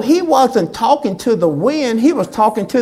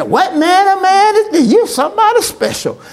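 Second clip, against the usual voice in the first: mock amazement; 8 semitones higher, 4 dB louder than usual. Disciples marvelling, mocked.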